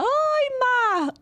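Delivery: retold high delighted squeal; excited greeting. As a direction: burst in like a friend popping up live. Roberta greets her on live.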